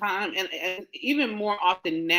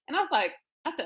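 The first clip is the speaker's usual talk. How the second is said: normal talking voice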